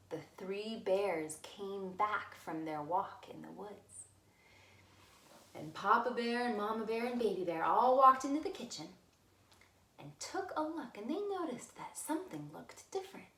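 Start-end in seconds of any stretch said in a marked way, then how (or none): none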